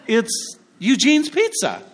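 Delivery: savoring the name Eugene's, slow and high; proud local. Retold or imitated. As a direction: announce it like the obvious local pick. Playing the local insider.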